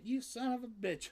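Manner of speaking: high squeaky teasing cry; high mock-insult to a pet; playful pet squeal. Real, not acted. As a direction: squeal it at the cat in mock outrage.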